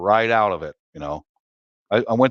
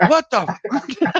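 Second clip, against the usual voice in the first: much higher stunned cry; shocked disbelief; 12 semitones higher than usual. Retold or imitated. Ladyboy realizing the Frenchman speaks English, much higher stunned cry. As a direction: blurt in total shock.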